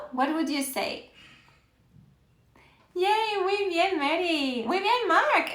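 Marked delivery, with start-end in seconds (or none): 3.0-5.5 excited praise. Real, not acted. Teacher cheering a correct answer.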